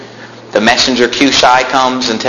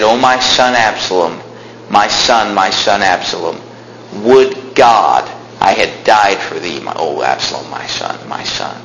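mournful cry sinking lower and softer into a whisper; grieving lament; 6 semitones lower, 4 dB quieter than usual. Acted. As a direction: let the father's grief fade to nothing. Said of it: "and wept".

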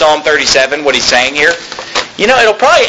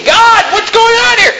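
much higher, fed-up plea to God; exasperated cry; 14 semitones higher than usual. Retold or imitated. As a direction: throw your hands up at heaven. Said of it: "you just say".

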